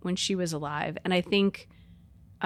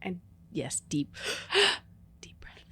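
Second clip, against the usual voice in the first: whispering; 6 dB quieter than usual. Pretend. Mock soap drama, whispered.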